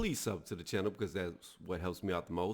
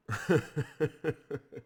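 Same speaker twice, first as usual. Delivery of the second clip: scornful laugh; 6 semitones higher than usual. Real, not acted. Laughs at the WBC.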